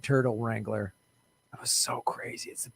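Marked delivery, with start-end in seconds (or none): 1.5-2.7 hushed, awed whisper. Real, not acted. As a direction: whisper it in disbelief. Sudden whisper.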